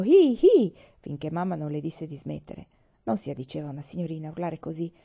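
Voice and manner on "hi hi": high, loud playful yell; shouting. A narrator's reading. Laura running about copying the cowboy, yelling. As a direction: whoop like a little cowboy.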